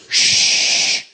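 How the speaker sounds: a sharp, unvoiced shush; God silencing the praying pastor with a firm, breathy shush. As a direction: hush him with one breathy shh. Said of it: "So loud and so shuddering in my spirit".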